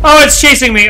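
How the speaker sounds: loud, panicked yelp; scared horror game streamer. Real, not acted. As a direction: panic as the monster closes in. Monster chasing him in game.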